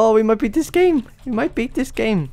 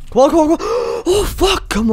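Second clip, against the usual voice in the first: high, panicky pleading with a gasp; frantic urging; 8 semitones higher, 4 dB louder than usual. Real, not acted. Gamer urging on a tricky jump, frantic high voice. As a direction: plead at a high pitch as it slips away.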